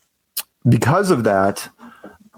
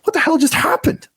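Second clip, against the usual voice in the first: high, baffled exclamation; retelling his stunned confusion at being thrown out; mock bafflement. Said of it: "I'm going".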